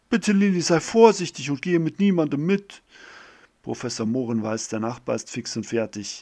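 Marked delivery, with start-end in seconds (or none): 0.1-2.8 high, loud, pleading warning from an anxious mother; worried mother. Acted. Mother calls after Lilly going to the river, anxious and loud. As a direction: call after her, worried sick. Mother warns daughter leaving.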